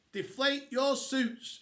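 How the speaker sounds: slow, high-pitched yell; yelled order. A narrator's reading. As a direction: yell it out as a drawn-out command. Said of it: "she yelled".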